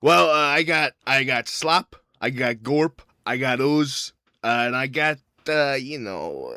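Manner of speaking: loud shady alien vendor; loud, halting sales patter in character; alien doctor. Acted. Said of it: "he looks and he goes".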